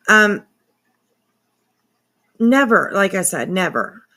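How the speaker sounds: ordinary talk, nothing marked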